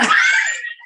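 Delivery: very high, loud shriek of laughter. Shown as a demonstration. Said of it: "It is such a".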